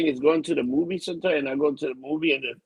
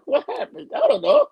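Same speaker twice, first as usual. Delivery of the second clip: dazed confusion; 13 semitones higher, 5 dB louder than usual. Retold or imitated. Retelling waking up after knockout.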